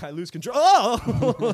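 "control" broken off by a loud, high "Oh!"; tickled mid-word outburst. Retold or imitated. Retelling being tickled while saying control, sudden yelp. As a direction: start normally, then burst into a loud yelp. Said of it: "got me in the middle of me saying".

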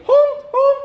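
very high, loud double honk; flamingo honk. Shown as a demonstration. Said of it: "Flamingo say".

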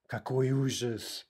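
slow, theatrical dismay; hammed-up horror, drawn out; mock horror. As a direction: play the tragedy slowly.

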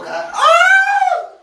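high scream from the cold; loud cry of pain; shouting. Real, not acted. Still in ice water.

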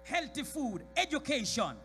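high, loud listing; building exhortation; preaching shout. Real, not acted. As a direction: shout each blessing out. Preacher listing blessings loudly.

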